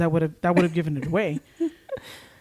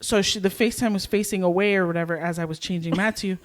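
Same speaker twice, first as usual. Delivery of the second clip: ordinary talk, nothing marked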